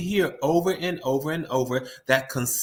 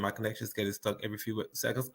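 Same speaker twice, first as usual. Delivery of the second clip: ordinary talk, nothing marked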